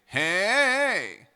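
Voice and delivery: high, loud chest-voice Hey!. Shown as a demonstration. Coach calls out a chest-voice Hey!. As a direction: feel it buzz on the sternum. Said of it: "everybody say".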